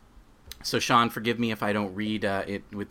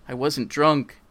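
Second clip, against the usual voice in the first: louder, pushing the words out; insisting; 5 semitones higher, 6 dB louder, slower than usual. Acted. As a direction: insist it, denying hard. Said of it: "Dan insisted".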